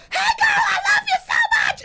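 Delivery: high, fast, shouted greeting; imitating screaming neighbor. Friend mocks the loud neighbor's gushing scream. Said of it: "she is always in a full-blown scream".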